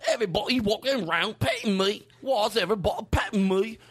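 high-pitched, hammed-up Cockney dog voice; Cockney accent. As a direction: voice a dog in bad mock Cockney.

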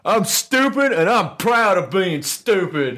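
gururlu cahil taklidi. Acted. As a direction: drag out a proudly stupid boast. Voicing proud ignorant Americans.

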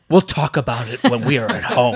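stern husband. Retold or imitated. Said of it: "her husband was like".

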